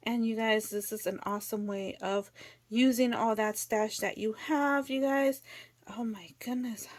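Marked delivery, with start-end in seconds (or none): none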